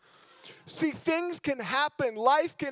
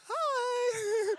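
put-on much higher, sweet young girl voice; imitating a sweet girl; girl student; 13 semitones higher than usual. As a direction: chirp it like a shy student girl. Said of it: "she's like".

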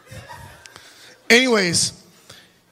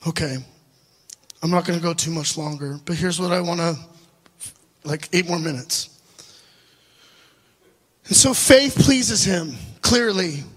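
ordinary talk

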